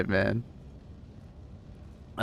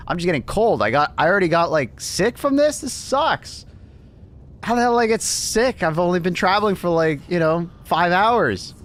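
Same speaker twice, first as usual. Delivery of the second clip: higher-pitched retold griping; acted exasperation; 6 semitones higher than usual. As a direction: complain as your put-upon past self, pitched up. Acts out past self complaining.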